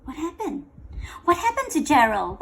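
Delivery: usual speaking voice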